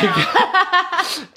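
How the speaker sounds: a high, squealing burst of laughter. Real, not acted. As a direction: let out a shrill squeal of a laugh. Laughs at grinding with mom.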